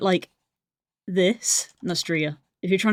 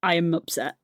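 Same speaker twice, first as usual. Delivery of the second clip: ordinary talk, nothing marked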